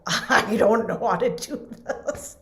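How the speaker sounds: slow, laughing helplessly. Retold or imitated. Child at the chalkboard, laughing helplessly. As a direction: giggle through the confession.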